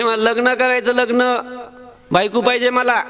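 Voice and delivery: a hint of a pleading little boy; child Krishna. Little Krishna asking the milkmaid for a wife, lightly childlike. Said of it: "ती म्हणे".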